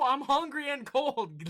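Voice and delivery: slow, loud, high complaint; fed-up complaint. Real, not acted. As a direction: drag out the misery. Exasperated rant continues.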